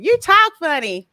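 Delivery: high, loud mock taunt of a kid; teasing. Neighbor kids mocking her accent, teasing. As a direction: imitate neighbor kids poking fun, loudly. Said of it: "make fun of my accent".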